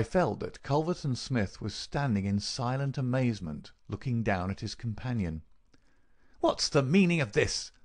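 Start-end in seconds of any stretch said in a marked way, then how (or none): 6.4-7.7 higher, dry demand for an answer; a touch of dry rasp, pitched up. A narrator's reading. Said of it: "in a dry, rasping tone".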